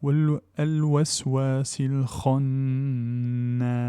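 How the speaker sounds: very slow chanted tajwid recitation of al-waswasil khannas; Quranic recitation. Shown as a demonstration. Teacher reciting the verse for students, very slow and chanted. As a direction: intone the verse, long and measured.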